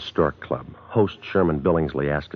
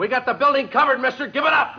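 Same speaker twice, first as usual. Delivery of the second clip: shouted demand to surrender. Acted. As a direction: order him to give up, shouting. Cop calls up to gunman.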